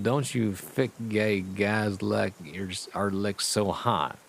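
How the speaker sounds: usual speaking voice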